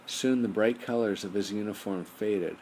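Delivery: plain reading voice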